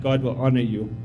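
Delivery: ordinary talk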